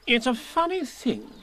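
high, slow fussy Rabbit. A narrator's reading. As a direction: Rabbit pondering importantly.

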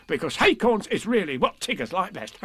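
high, eager tiger voice with a chuckle; cheery storybook tiger; Tigger. A narrator's reading. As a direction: explain it high and bouncy, then laugh. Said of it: "said Tigger".

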